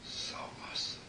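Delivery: a hushed, reverent echo; awed whisper. Acted. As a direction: whisper it in awe.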